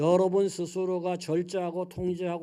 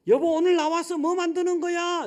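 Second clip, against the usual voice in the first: high, loud call; calling louder; 11 semitones higher, 9 dB louder than usual. Retold or imitated. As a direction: raise the voice to be heard. Husband calls from kitchen door. (부엌 입구에서 아내를 크게 부르며)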